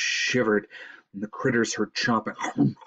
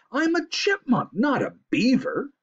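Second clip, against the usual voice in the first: high, indignant chipmunk squeak; indignant protest; 4 dB louder than usual. A narrator's reading. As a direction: protest it, pitched way up. Squirrel protests the swimming idea.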